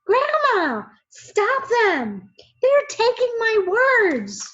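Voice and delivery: high, loud distressed cry; desperate child yelling; panicked shout. A narrator's reading. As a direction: plead with grandma at full voice. Boy panics as words taken.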